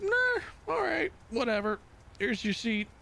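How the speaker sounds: high, offhand brush-off voice; mocking an indifferent official handing out a seat; dismissive military clerk. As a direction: brush him off like a bored clerk. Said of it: "they're just like".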